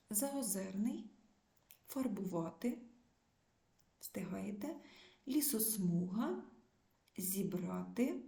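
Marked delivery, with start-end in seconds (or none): 4.0-4.7 soft aside. Real, not acted. Teacher checks pupils mid-task.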